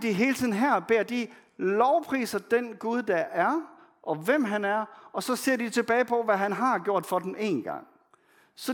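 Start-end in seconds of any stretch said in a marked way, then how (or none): none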